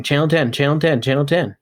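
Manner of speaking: plain reading voice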